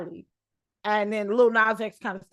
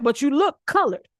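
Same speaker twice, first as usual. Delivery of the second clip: imitating stranger; 6 semitones higher, 4 dB louder than usual. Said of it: "He was like".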